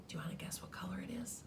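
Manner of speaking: quick conspiratorial whisper; hurried sly whisper; fast whisper. Acted. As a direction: rush it out under your breath.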